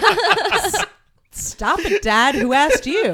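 high laughing protest. Retold or imitated. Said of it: "And I'm just like".

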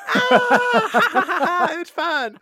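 high-pitched mock squeals of joy; retold delight. Girlfriend having a great time on the ride, gleeful. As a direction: whoop and laugh like a thrilled rider. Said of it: "she's having a great time".

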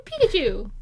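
ordinary delivery

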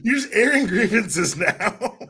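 high, slow, drawn-out tease through laughter; laughing tease. Real, not acted. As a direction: tease them while cracking up. Teasing the guest while laughing.

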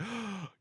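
quick startled intake of breath; sharp gasp of realization. Retold or imitated. Stranger realizing his mistake, startled gasp. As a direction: gasp as it finally clicks. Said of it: "he's like".

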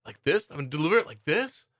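put-on high, questioning stiff kid delivery; bad kid acting. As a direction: read each line like an unsure child actor. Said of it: "All of his lines were like".

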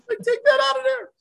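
laughing aside. Real, not acted. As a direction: laugh it off, telling them to cut it. Laughing off a joke about himself.

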